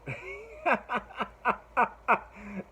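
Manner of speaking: high, amused burst of laughter. Real, not acted. Vlogger laughing at a petition to put [name] on the ballot, amused laugh.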